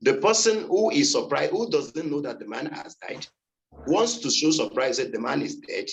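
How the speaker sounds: ordinary talk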